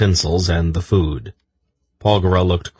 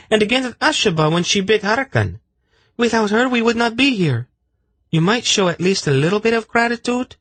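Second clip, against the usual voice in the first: much higher, scolding voice; indignant high woman. A narrator's reading. Zenedra scolding Silk for ingratitude, indignant high voice. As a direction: defend the snake, indignant.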